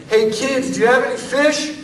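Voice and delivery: high, loud call from the shore; mock yell. Preacher mimicking a stranger yelling at fishermen from shore, loud and high. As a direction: shout it like a stranger hollering at a boat. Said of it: "Some random guy on the shore yells at them".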